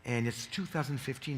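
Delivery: ordinary talk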